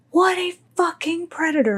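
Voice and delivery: high-pitched retold outrage; outraged friend. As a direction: imitate a friend going off the rails. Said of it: "she is like, off the rails".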